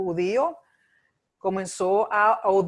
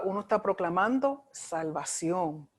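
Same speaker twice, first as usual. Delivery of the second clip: ordinary talk, nothing marked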